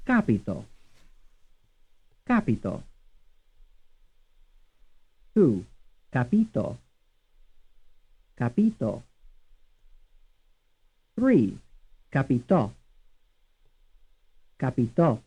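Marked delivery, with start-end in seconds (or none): 0.1-2.8 "Capito" as CA-pi-to; stress on first syllable. Shown as a demonstration. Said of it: "stressed on the first syllable". 6.1-9.0 "Capito" as ca-PI-to; stress on second syllable. Shown as a demonstration. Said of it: "stressed on the second syllable". 12.1-15.2 "Capitò" as ca-pi-TO; stress on third syllable. Shown as a demonstration. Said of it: "stressed on the third syllable".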